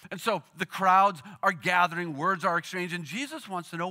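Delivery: normal talking voice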